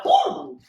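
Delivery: high loud jump scare shout; scary whoa. Pretend. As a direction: leap out and yell to scare. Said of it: "I can scare people, like".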